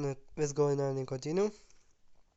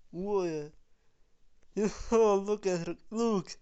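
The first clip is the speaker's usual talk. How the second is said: excited; 5 semitones higher, 4 dB louder, slower than usual. Real, not acted. Excited reaction to a character.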